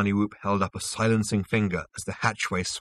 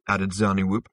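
usual speaking voice

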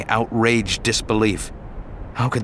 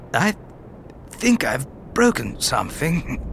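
softer, strained voice of a hurt man; weak, wounded Obi-Wan; 4 semitones higher, 4 dB quieter than usual. Acted. Hurt Obi-Wan admits injury, soft and strained. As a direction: wince through the words.

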